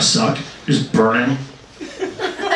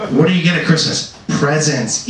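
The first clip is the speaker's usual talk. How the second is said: usual speaking voice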